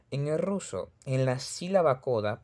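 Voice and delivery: ordinary talk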